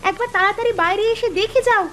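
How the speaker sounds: high-pitched, astonished calling out; excited hollering. Acted. Wife calling husband out to see a marvel, excited cries. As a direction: call him urgently, pitch leaping up. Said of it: "চিৎকার চেঁচামেচি করছো".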